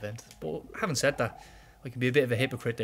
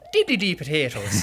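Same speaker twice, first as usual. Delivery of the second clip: slow, louder stage-Irish "Dee dee dee potatoes"; mock Irish accent; 4 semitones higher, 7 dB louder than usual. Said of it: "ripping the piss out of us".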